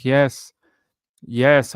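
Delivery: normal talking voice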